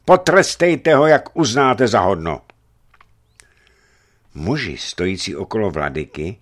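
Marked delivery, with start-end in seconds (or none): 0.0-2.4 high, loud proclamation; loud command. A narrator's reading. Varlám tells the crowd to punish the boyar, loud command.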